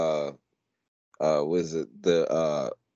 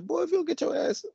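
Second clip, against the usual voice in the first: put-on much higher, fast scolding yell at a kid; scolding parent; faster than usual. Parent scolding a kid in the family way, high and fast. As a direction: snap at a misbehaving child. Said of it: "We're like".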